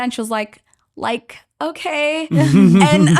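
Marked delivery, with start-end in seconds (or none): 1.0-2.3 put-on high, slow, drawn-out Valley girl. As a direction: answer as a mock Valley girl. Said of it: "You're Becca from the Valley".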